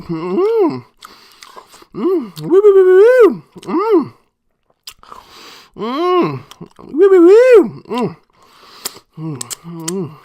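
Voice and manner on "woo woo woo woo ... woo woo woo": loud high hooting of delight; excited hooting. Real, not acted. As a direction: hoot over and over with pleasure. Savoring a bite of meat.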